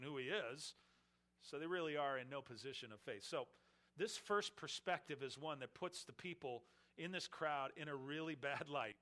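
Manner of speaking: ordinary talk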